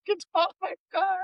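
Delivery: mock high-pitched pleading teen voice; squeaky sixteen-year-old. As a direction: plead in a tiny squeak, hammed up. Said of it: "with my squeaky little sixteen-year-old voice".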